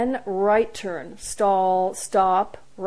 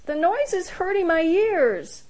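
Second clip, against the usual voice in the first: put-on childish whine; 10 semitones higher, 3 dB louder than usual. A narrator's reading. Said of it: "whined Luca".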